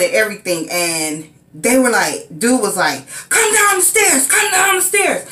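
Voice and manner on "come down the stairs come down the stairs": high, barked order from below; police command shout. Retold or imitated. Officer ordering the woman down the stairs, high mock shout. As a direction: order her down like an armed cop.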